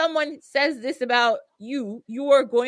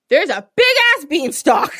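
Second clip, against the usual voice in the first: loud, high burst of astonishment; amazed reveal; 6 semitones higher, 10 dB louder than usual. Retold or imitated. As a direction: announce it big, as a huge surprise. Reveals the overnight giant beanstalk.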